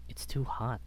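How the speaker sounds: ordinary delivery, nothing marked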